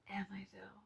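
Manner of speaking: breathy whispered repeat of the question. Shown as a demonstration. Talking to her subscribers, she whispers the question again. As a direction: drop to a secret whisper.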